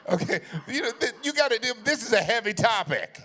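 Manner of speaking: high, shouted plea over laughter; loud, high, mock-exasperated protest; playful loud protest. Real, not acted. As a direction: holler it at a laughing crowd.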